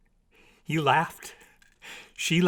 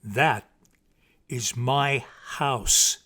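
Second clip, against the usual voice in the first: slow, loud, each word set apart; teacher's clear tones; 6 dB louder, much slower than usual. A narrator's reading. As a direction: spell it out like a teacher. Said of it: "clear, divided tones of a teacher".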